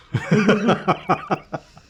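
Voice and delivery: high, loud burst of laughter; real amused laugh. Real, not acted. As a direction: burst out laughing at your own joke. Joking about escaping in style.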